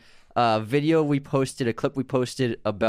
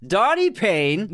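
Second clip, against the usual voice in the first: high, slow, louder Donnie Payne; announcer hype; 9 semitones higher, 4 dB louder, much slower than usual. Acted. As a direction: announce him like a big arrival. Shout-out announcement.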